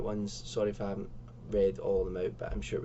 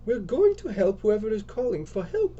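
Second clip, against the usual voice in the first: high, loud, insistent character voice; Lisa's character voice; 10 semitones higher, 5 dB louder than usual. Acted. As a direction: answer firmly in a high put-on voice. Lisa answers in character.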